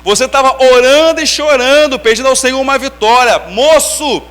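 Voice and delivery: slow, loud prophetic pronouncement, drawn out; profeta pregando. Retold or imitated. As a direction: proclaim it like a prophet at the altar. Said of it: "falou assim". (voz de profeta, lenta e forte)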